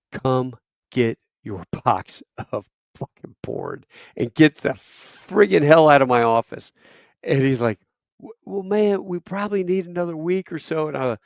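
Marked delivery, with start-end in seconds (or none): 0.1-6.7 retold angry outburst; exasperated order. Retold angry phone call to doc. 8.2-10.9 high, hesitant mock voice; stammered, higher-voiced stalling; stalling doc. Imitates doc stalling on phone.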